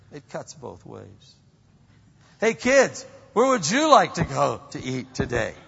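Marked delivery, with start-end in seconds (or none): 2.4-5.5 put-on higher, louder bright call to the kids; a parent offering the kids a meal, loud and bright; cheerful parent. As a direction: sing it out to the kids.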